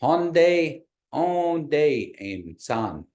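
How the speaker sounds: very slow demonstration, high start, broken before em san; really slow. Shown as a demonstration. Teacher lets a new phrase soak in, really slowly. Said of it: "I'll say it once again".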